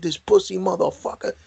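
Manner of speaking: high contemptuous bark of orders; angry drill sergeant. Retold or imitated. Drill sergeant ordering the fainted recruit dragged off, high angry bark. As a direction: snarl it like a disgusted sergeant.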